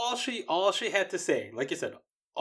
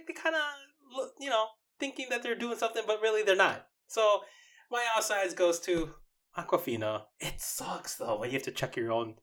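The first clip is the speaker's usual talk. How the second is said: ordinary talk, nothing marked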